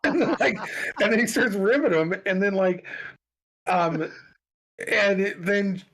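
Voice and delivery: words spilling out through laughter; laughing while talking. Real, not acted. Laughing at the prank story.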